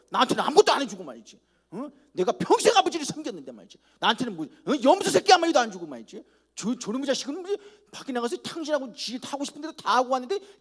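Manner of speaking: high, loud grumbling complaint; mock angry son complaining to his father; resentful older son. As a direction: whine your grievance at father. Acting the resentful older brother.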